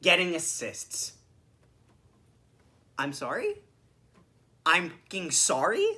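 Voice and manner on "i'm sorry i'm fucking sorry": low, soft, rising disbelief; quiet incredulity. Real, not acted. Fan reacting to the decision to pass, quietly incredulous. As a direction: drop the voice, question it in disbelief.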